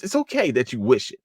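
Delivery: ordinary talk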